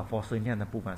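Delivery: ordinary talk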